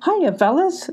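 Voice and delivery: high, sing-out hello; breezy greeting. Acted. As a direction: greet them to cause a distraction. Rose distracts the dogs.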